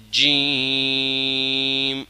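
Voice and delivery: جیم stretched long and very slow; drawn-out jeem. Shown as a demonstration. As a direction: drag out جیم slowly. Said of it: "جیم کو ذرا کھینچ کے پڑھنا ہے".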